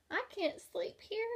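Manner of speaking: high little-bug voice; the ladybug complaining on the bear's ear, high pitched; squeaky ladybug. A narrator's reading. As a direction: pipe up like a tiny ladybug. Ladybug character voice.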